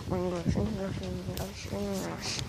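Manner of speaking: the word repeated, dropping to near silence; fading whisper. Pretend. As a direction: fade the repetition out to a breathy whisper.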